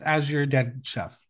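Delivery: ordinary talk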